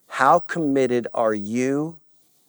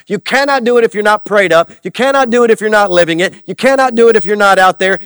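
high, loud preacher's cry; full-force preaching; 10 semitones higher, 13 dB louder than usual. Acted. Preacher crying out to the congregation, loud and high. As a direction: preach it out to the church.

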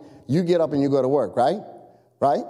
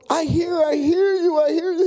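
high, strained reassuring call; urgent calling back; 13 semitones higher, 3 dB louder than usual. Acted. Marine to trapped firemen below, calling back urgently. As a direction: shout down to someone trapped.

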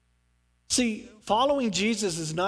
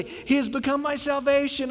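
mock crowd cheer; 7 semitones higher than usual. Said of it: "the people would say".

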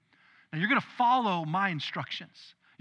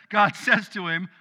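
ordinary delivery

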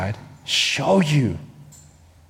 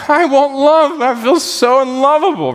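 woman's plea; 8 semitones higher than usual. Acted. Woman voiced pleading.

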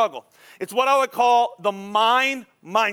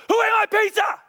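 put-on teen freaking out; 13 semitones higher than usual. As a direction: yell it like an outraged teenage son. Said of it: "He freaks out".

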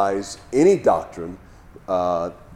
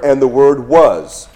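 "was" drawn out slowly and leaned on; slow down and hit "was"; stress on was; 4 semitones higher, 12 dB louder, slower than usual. Shown as a demonstration. Teaching the imperfect tense, slowly stressing "was". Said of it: "the word "was" is the imperfect tense".

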